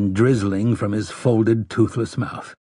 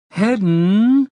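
high, drawn-out whining call; irritating whine; 11 semitones higher, 4 dB louder than usual. A narrator's reading. Sister calling her brother from the yard, whining. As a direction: stretch the name into a high whine. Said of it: "That irritating whine again".

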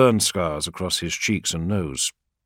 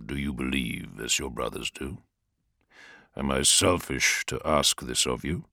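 lower, softer growling plea; deep growl; 6 semitones lower, 5 dB quieter than usual. Acted. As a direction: rumble the question low. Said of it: "his deep voice almost a growl".

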